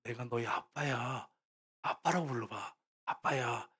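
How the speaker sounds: soft, slow and low, coaxing gently; hushed, patient fatherly voice; warm, loving father. Acted. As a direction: speak tenderly to a frightened child. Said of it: "따뜻한 눈으로 바라보면서, 사랑의 눈으로".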